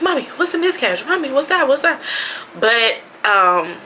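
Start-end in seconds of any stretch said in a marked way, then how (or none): 0.0-2.0 put-on higher, fast little-girl voice asking; a light childlike question voice; imitating her kids. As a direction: mimic curious daughters, high and quick. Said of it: "I didn't want them to be like".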